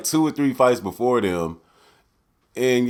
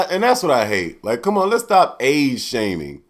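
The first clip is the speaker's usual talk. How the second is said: normal talking voice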